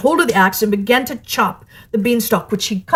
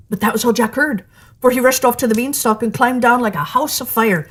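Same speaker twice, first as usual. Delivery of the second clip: ordinary delivery, nothing marked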